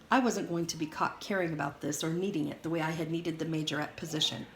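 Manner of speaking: plain reading voice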